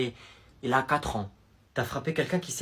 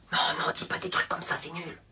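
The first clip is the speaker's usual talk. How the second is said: whisper; 9 dB quieter than usual. Acted. Friend whispering advice during phone call.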